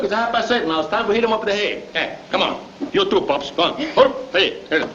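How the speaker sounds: ordinary delivery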